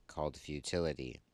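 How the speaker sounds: ordinary talk, nothing marked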